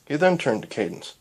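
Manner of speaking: ordinary delivery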